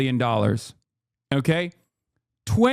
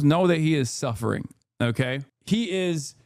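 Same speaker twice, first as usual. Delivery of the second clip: normal talking voice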